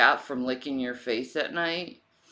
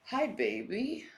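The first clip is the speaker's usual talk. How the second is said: pet talk; 6 semitones higher, 3 dB quieter than usual. Acted. Greeting her cat.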